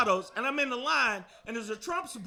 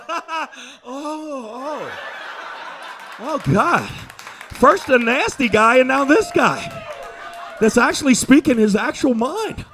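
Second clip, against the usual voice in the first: mock shocked crowd; 3 semitones higher, 10 dB louder than usual. Imitating crowd's shocked reaction.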